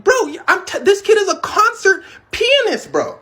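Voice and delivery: high, tumbling, breathless disbelief; can't get the words out for amazement; stunned excitement. Retold or imitated. Stunned by kid's talent.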